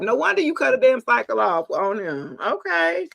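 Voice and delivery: ordinary talk, nothing marked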